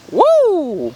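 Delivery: loud, high whoop of delight; excited cheer. Real, not acted. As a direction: cheer the shot at the top of your voice. Cheering a good shot.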